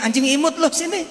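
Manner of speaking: put-on high cooing baby talk to a pet; cutesy dog talk. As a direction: coax a cute puppy over. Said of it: "Ngomongnya yang cakep gitu".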